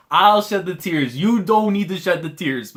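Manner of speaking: emotional insisting. Real, not acted. Insists he will cry instead.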